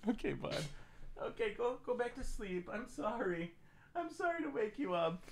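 quiet tender pet talk; soft puppy talk. Real, not acted. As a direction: let him drift back to sleep. Putting puppy back to sleep.

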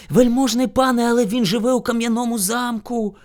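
high-pitched, protesting hare; timid hare. A narrator's reading. Hare warns bear about the castle, anxious. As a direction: caution a master meekly.

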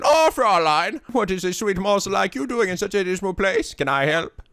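the voice he always wants for Fräulein; German accent. Acted. Showing viewers the voice he uses for Fräulein. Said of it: "I wanna say it in".

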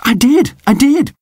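high, eager insisting; little dog insisting he flew; insistent pup. A narrator's reading. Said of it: "said Sid".

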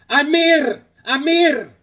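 high, loud call across the room. Retold or imitated. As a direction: call his name from afar. Calling to gas station guy.

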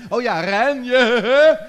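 slow, drawn-out loud shout; mock shout. Host teasing the kids with the game name, shouting. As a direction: bellow the game's name with relish.

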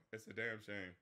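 "It's a damn shame" said low and soft, trailing away; quiet. Real, not acted. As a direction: let the repeat sink into a sigh. Repeated lament, dropped low.

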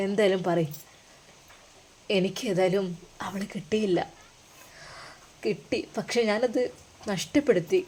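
hushed. Acted. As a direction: lean in and confess quietly. Hushed confession in car.

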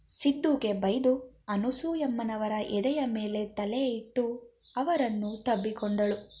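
ordinary delivery

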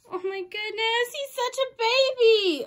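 high, slow, loud coo. Real, not acted. Cooing over the plush.

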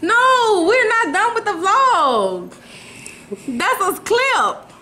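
high, slow, drawn-out protest; exasperated correction. Real, not acted. Correcting co-host who wants an outro, exasperated and high.

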